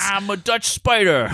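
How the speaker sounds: high, exaggerated Queens accent; mock Queens accent. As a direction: attempt Brooklyn, land comically in Queens. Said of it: "That's, that's Queens".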